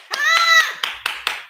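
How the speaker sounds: short, loud, high-pitched "ah"; high squeal. Real, not acted. Coach to viewers who said yes, high squeal. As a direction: let out a sudden high squeal.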